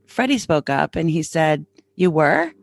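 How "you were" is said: mildly surprised retold question. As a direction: imitate a friend's rising, surprised query. Friend reacts to interview news.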